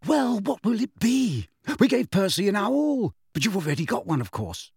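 high, gushing breathless mother; breathless excitement. A narrator's reading. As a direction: start high and excited, then drop away. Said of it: "said breathlessly".

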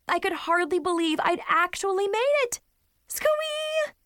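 high, excited squee; thrilled squeal. Acted. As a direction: burst with joy. Thrilled at making the plane.

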